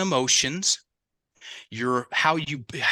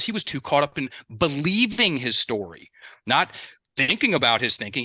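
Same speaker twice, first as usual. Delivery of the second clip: normal talking voice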